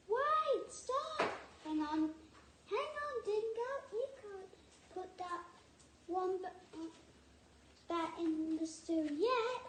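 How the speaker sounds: high panicked pleading; panicked cry. A narrator's reading.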